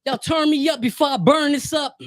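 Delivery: hammed-up rapper opening line; hyped rapper intro. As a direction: pump up the crowd like a rapper.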